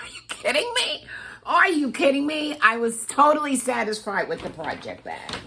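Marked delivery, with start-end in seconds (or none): 0.0-1.1 very high, whispery squeak; tiny breathless squeal of disbelief. Retold or imitated. Retold disbelief at more gifts. 1.5-2.6 high, full-voiced disbelief; retold astonished outburst in full voice; incredulous exclamation.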